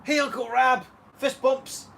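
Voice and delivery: high, loud imitated greeting; excited greeting. Dad acting out son greeting uncle, excited greeting. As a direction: call it out high and bright as an imitation.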